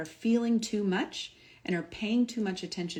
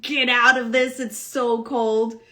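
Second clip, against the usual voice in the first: much louder, higher panicky plea; panicked body; 4 semitones higher, 10 dB louder, slower than usual. Retold or imitated. The body in the ice bath, shouting in panic to escape. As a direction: voice the body begging to get out. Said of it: "My body's like".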